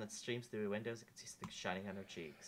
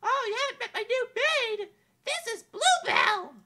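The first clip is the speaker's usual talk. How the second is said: put-on high, bright young princess, wistful; a lovely princess doubting fairy tales; princess Amelia voice; 16 dB louder, slower than usual.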